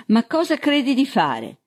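high-pitched protest, falling at the end; exclaiming. A narrator's reading. Man with a pistol in his ear, exclaiming in protest. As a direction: challenge him, pitched well up. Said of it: "esclamò".

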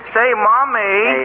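put-on slow, drawn-out little-boy whine; childish little boy voice. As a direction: play the sweet little kid asking Mommy. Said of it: "Take that lollipop out of your mouth".

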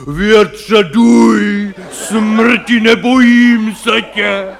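hammed-up stage hero shouting at death, slow; mock theatrical bluster with a mocking ha ha; mock heroic roar. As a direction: bellow defiance like a ham actor. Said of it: "křičej tam, prostě řvou".

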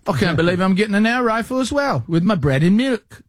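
high mock British accent, amazed; British accent imitation. Host riffs on the British shopper in a mock British accent. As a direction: play the stunned British shopper. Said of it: "British guy in Walmart".

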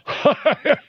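short high delighted chuckle; real amused laugh; gleeful laugh. Real, not acted. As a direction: laugh with relish. Delighted by Rubio clip.

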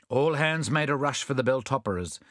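usual speaking voice

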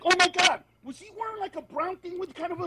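high, mocking backstage gossip voice; mock gossip, pitched well up; gossiping comedian. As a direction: play a catty comic dishing about the crowd. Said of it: "the other comedians will be like".

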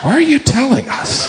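high put-on voice; high, mock-puzzled reproach; disapproving listener. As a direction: play the onlooker questioning the bragger. Disapproving listener answers the cake bragger.

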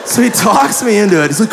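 ordinary delivery

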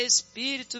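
ordinary talk, nothing marked

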